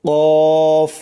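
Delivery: Arabic qaf said thick; firm and thick. Shown as a demonstration. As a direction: hit কফ heavy and firm. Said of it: "মোটা করে".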